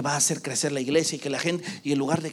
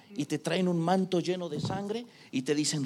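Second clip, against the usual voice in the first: ordinary talk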